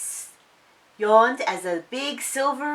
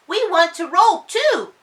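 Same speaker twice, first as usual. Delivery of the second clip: little duckling voice; 10 semitones higher, 7 dB louder than usual. Acted. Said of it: "said Polly".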